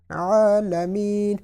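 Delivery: slow عالمین with the ee drawn out; long ya. Shown as a demonstration. Teacher showing the long ya in عالمین. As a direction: stretch the ee in عالمین. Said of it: "یا ساکن سے پہلے زیر ہے تو انہوں نے یہاں پر اس کو لمبا کیا ہے".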